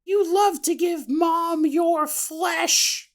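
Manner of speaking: high sweet mother voice; creepy mother voice. Acted. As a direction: coo like a monstrous mom. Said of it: "still using his mother's voice".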